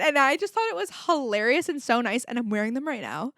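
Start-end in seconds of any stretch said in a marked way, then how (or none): none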